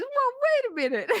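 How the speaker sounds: high mock double take; a sudden high "wait" as it dawns; startled Bill. Bill realizing it's about murder, high mock alarm. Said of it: "he goes".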